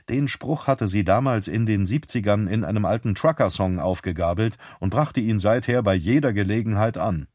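ordinary delivery, nothing marked

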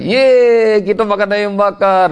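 high, gleeful retold cheer; child's excited cheer. Daughter cheers over promised grilled chicken, high excited retold shout. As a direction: cheer like a kid promised a treat. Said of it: "Anak saya kemudian teriak".

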